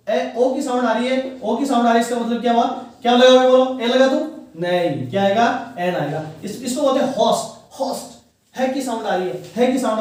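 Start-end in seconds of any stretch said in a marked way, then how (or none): none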